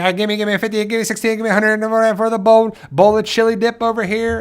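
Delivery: fast, high auctioneer patter. Retold or imitated. Mimicking an auctioneer at a chili dip auction, fast and high. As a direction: rattle off bids like an auctioneer. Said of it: "so they're like".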